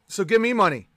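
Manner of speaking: ordinary delivery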